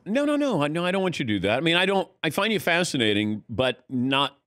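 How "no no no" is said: high, quick no no no; flustered denial. Real, not acted. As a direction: backpedal in a rush.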